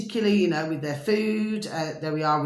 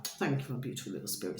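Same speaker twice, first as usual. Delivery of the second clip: hushed aside; 8 semitones lower, 13 dB quieter, faster than usual. Real, not acted. Soft aside thanking a spirit.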